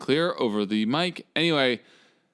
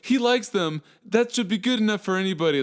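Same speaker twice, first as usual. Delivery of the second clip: high, mocking huff of a defensive fan; mock indignant fan; 10 semitones higher than usual. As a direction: lampoon an offended fanboy, pitched up. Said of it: "and you're like".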